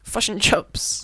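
high, slow mock Kiwi vowels; broad Kiwi accent. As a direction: stretch out "Fush and chops" like a Kiwi. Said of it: "Sorry, all the Kiwis".

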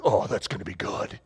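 low, soft savouring aside; quiet relish. Acted. Wall-sit fan anticipating the challenge, quiet relish. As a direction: relish it softly, pitched right down.

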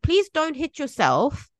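lightly voiced mother begging her child to stop; mock pleading mother.

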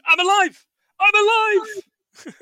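high, slow, drawn-out cry of joy; hammed-up ecstatic shout of being alive; mock joyful cry. Film fan acting out the reborn Scrooge, joyful cry.

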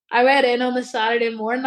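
normal talking voice

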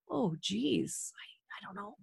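breathy hushed whisper. Shown as a demonstration. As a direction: whisper it in mock puzzlement. Playful mouth inspection.

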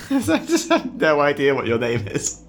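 laughing while talking; giggling through the words. Real, not acted.

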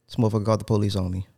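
ordinary delivery

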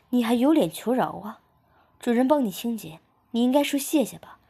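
acted outburst of reproach; indignant scolding. A narrator's reading. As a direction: scold sharply, raising pitch and volume. Acted scolding after a slap.

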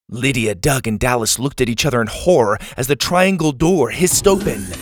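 usual speaking voice

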